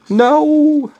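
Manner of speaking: high, loud "no!"; dismayed cry. Real, not acted. Streamer crying out as the game is lost, high and loud. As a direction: cry out in protest, pitch soaring.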